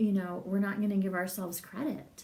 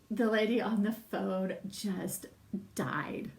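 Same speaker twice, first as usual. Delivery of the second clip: usual speaking voice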